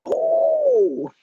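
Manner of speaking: high, stirred-up onlooker "ooh"; drawn-out high hoot; Kelly's mock "ooh". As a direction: hoot it high from the back of the room. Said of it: "Kelly in the back".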